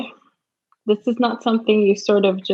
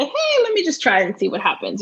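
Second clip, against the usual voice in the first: lightly voiced breezy student, higher pitch; imitated casual applicant; 7 semitones higher than usual. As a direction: lift it high like a whim. Mimics a casual, unprepared applicant.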